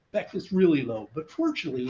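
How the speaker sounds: ordinary talk, nothing marked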